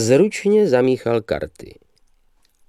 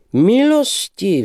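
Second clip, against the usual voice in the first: high, drawn-out gushing call; crying out; 11 semitones higher, 4 dB louder, much slower than usual. A narrator's reading. Young man hails a lady arriving, crying out. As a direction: call out long and high in greeting. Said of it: "vzkřikl mladík".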